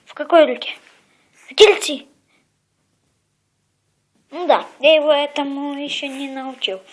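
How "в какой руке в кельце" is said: loud questions to the dog; talking to a dog. Real, not acted. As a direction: quiz the pet loudly. Asking dog which hand.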